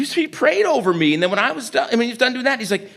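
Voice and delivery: normal talking voice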